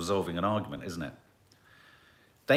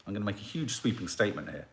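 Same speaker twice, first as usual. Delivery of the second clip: quick restatement; faster than usual. Real, not acted. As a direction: rattle it off briskly after a little apology.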